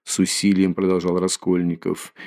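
ordinary delivery

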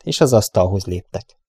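ordinary delivery, nothing marked

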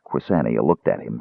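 ordinary delivery

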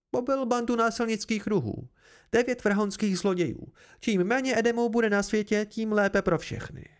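high-pitched, scornful argument; vehement noblewoman. Acted. Meluan argues with husband. (rozhořčená šlechtična, vyšším hlasem)

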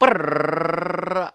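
long trilled drum-roll noise. Pretend. Drum roll before tallying minutes.

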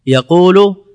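teacher emphasizing the original form for the class; stressed example word. Shown as a demonstration. Teacher spotlighting the example word, loud. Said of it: "أصلها يقول".

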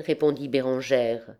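ordinary delivery, nothing marked

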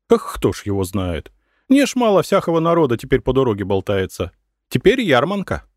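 lightly voiced village woman, quickening and rising; chatty peasant woman. A narrator's reading. Peasant woman explaining the fair crowds to merchants, lightly voiced chatter. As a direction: gossip along, lifting the pitch as it goes.

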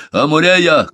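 loud, high call of the name; shouting. A narrator's reading. Беккер summons Амурея to his plan, shouting. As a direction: call out to her forcefully. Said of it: "крикнул он".

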